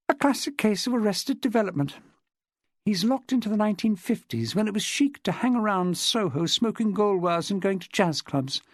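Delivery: lightly voiced chatty woman witness. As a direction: lightly play a woman recounting her club life. Narrator voices Jessica in dialogue.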